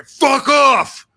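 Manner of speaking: loud, high furious shout; aggressive misreading. Shown as a demonstration. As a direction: bellow it as a hostile dismissal. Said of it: "you could read that and say".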